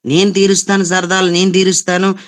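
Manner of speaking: imitating the mother. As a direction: play the mother boasting she will spoil her. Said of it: "అని పెళ్లి చేసిన తల్లే".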